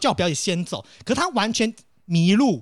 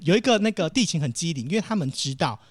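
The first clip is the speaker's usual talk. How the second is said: ordinary talk, nothing marked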